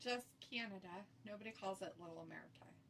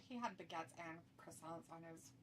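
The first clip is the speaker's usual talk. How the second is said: normal talking voice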